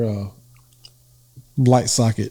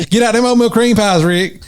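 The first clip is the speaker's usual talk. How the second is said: higher-pitched country granny scolding; mock scolding, pitched up; scolding meemaw; 10 semitones higher than usual. Meemaw catching Rick in the snacks, mock scolding. Voices meemaw teasing a fat kid.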